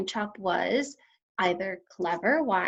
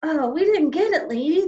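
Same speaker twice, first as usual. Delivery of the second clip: louder, higher sheepish apology; apologetic policemen; 7 semitones higher, 7 dB louder than usual. A narrator's reading. Policemen apologize to Missus Lambchop. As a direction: backpedal quickly. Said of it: "they said".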